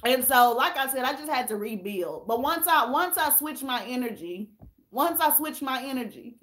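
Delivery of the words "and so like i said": usual speaking voice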